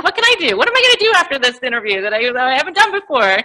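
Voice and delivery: high, fast, eager inner voice; excited. Retold or imitated. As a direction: rattle it off like you can't wait. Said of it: "And I'm like".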